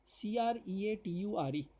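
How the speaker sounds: slow letter-by-letter spelling, each letter of सीआरईएटीयूआरई said apart. Shown as a demonstration. Teacher spells a word for students, slow letter by letter. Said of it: "spelling सुनिएगा".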